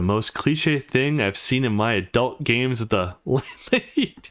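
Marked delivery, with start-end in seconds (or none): 2.8-4.2 amused, laughing through the word. Real, not acted. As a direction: pitch jumps up into a laugh. Player laughs at cliche game plot.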